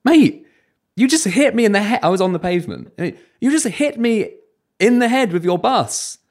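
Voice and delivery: high, loud outraged retelling; mock-outraged shout at the bus driver; indignant yell. Retelling yelling at a bus driver who hit him, outraged.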